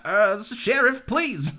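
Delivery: high, stammering wheedle; weaselly townsperson. Retold or imitated. Co-host mimicking the non-hero cowboy voice, weaselly pleading. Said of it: "is kind of this more weaselly".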